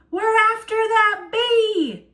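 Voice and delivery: high, loud call on the run; excited shout. A narrator's reading. Grandpa explains to the musicians, running shout. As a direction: shout it over your shoulder running.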